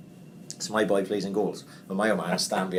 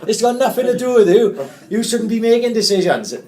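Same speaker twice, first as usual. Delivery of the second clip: high, loud telling-off; firm shout; 14 semitones higher, 9 dB louder than usual. Retold or imitated. Coach shouting back at the angry dad, firm and loud. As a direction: tell him off loudly, firm and sharp. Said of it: "Mickey said to him".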